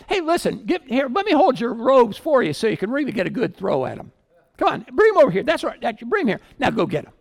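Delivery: urging the crowd to throw; eager egging on. Retold or imitated. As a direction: persecutor cheering on the stoning. Persecutor urging on the stoning.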